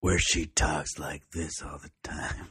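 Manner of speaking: slow, drawn-out tough-person voice; mock tough talk, dragging every word out; exaggerated slow tough-guy delivery; Uma Thurman. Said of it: "Uma Thurman's tough person voice".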